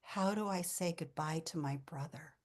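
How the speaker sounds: hushed, sorrowful question; softly imitated sadness, near a whisper; tearful retold plea. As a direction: quote a grieving sister softly. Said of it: "and she's crying".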